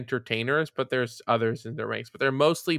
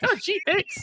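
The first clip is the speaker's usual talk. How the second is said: much higher, laughing mock-gratitude; sarcastic laughing thanks. Real, not acted. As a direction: brush off the compliment with a laughing squeak. Sarcastic reply to praise.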